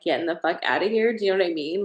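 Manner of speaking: ordinary delivery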